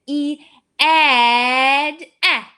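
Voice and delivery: "E, ed" loud and stretched; teacher extends a vowel for kids; extended E sound. Shown as a demonstration. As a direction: draw out the short e. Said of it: "let's say we extended it".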